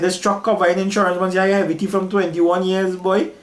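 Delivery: high-pitched, animated retold answer; mentor's assurance. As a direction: imitate a mentor settling doubts, pitched well up. Mentor answering worry, acted out.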